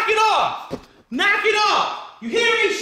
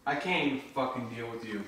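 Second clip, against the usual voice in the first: low, very soft grumble; exasperated muttering; 16 dB quieter, faster than usual. Real, not acted. Streamer gives up on Samantha, low exasperated mutter. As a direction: mutter it under your breath, fed up.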